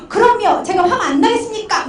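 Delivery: high, emphatic indignant protest; mock angry retort. Angry pastor answering counselor's empathy, high indignant retort. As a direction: imitate a client flaring up. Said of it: "화를 내시니까".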